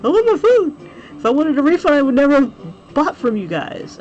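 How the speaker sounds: angry shout. Retold or imitated. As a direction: yell at the agent for your food. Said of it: "I'm like".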